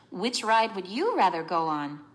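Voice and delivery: ordinary talk, nothing marked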